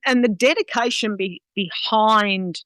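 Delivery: usual speaking voice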